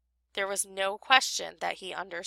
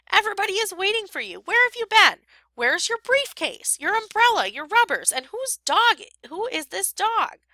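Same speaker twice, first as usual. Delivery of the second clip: scolding wife; 11 semitones higher, 4 dB louder than usual. A narrator's reading. Wife scolding late husband.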